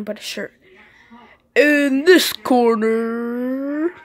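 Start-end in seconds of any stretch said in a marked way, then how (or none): 1.5-3.9 slow drawn-out ring announcer call. Acted. Ring announcer opening the match, slow booming call. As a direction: stretch it out like a boxing announcer.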